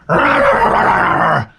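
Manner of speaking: growling dog noises; mock dog attack growl; imitated dog snarl. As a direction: snarl like a vicious little dog. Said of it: "he's like".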